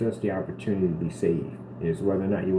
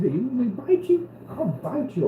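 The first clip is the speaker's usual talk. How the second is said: put-on baby talk to cat; slower than usual.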